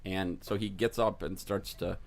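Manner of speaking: ordinary talk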